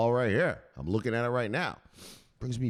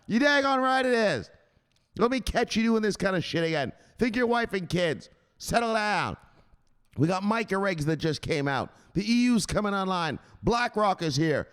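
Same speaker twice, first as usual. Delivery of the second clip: stern enforcer; 9 semitones higher than usual. Acted. Enforcer scolding a jittery crypto investor, high and forceful. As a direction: lay down the law to a nervous investor.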